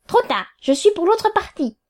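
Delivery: high, insistent dismissal; emphatic refusal. A narrator's reading. Lawyer refusing the opposing party's man, emphatic. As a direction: repeat it sharply, pitched well up.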